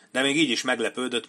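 usual speaking voice

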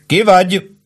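high, mildly thundered question; booming challenge. A narrator's reading. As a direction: demand identity like a sentry. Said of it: "dörögte ismét a hang".

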